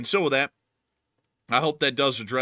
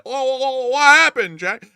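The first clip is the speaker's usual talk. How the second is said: very high, loud shouted impression; old impression voice; 13 semitones higher, 10 dB louder than usual. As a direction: shriek it out in his old voice. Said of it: "because I listen to everyone when they talk".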